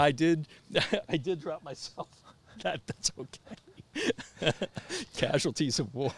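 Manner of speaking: high, laughing through the words. Real, not acted. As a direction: laugh while admitting it. Laughing about dropped phone.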